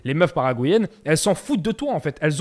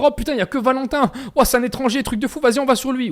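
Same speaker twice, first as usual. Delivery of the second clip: higher-pitched, excited imitation of locals; mock excitement; 7 semitones higher than usual. Locals spotting the only foreigner around, mock excited. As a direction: mimic people thrilled to spot a newcomer.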